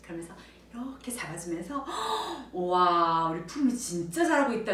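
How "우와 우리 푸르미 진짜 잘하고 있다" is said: admiring praise. Acted. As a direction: act impressed to encourage a child. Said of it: "제가 칭찬하는 소리에". (감탄하며 칭찬하는 말투)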